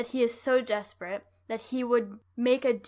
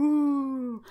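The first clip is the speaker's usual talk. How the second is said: spooky ghost ooh; 3 semitones higher, 6 dB louder than usual. Retold or imitated. Spooky ghost noise mocking.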